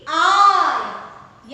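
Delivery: आई stretched out loud; long I sound. Shown as a demonstration. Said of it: "then also it is आई ई". (आई को खींचकर ज़ोर से)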